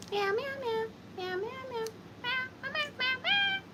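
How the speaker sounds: high mock meowing that climbs; cat meows. Answering the kitty cats offer.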